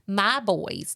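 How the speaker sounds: usual speaking voice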